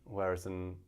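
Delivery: ordinary talk, nothing marked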